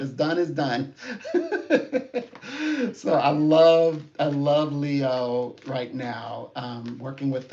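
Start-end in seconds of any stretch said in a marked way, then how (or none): 1.0-2.9 high, real burst of laughter; bright delighted laugh. Real, not acted. Big laugh after quote. 2.9-6.5 drawn-out delight. Real, not acted. Enthusing, drawn out.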